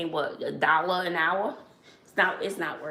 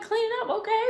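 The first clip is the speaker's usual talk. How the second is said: high, breezy "clean it up"; easygoing mom; 14 semitones higher, faster than usual. Retold or imitated. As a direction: tell the kids it's no big deal. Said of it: "I'm just like".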